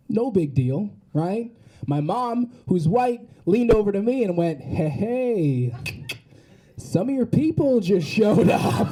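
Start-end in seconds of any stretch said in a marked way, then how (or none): 4.6-8.9 slow, drawn-out mock mom aside; imitating mom's slow, too-audible heads-up; indiscreet announcement. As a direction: stretch it out, tipping off your child indiscreetly. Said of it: "Keep your voice down".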